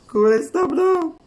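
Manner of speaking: high, loud, slow half-laughing telling off; stop now. Real, not acted. Speaker telling her laughing friend to stop, high and loud. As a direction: cut the teasing short.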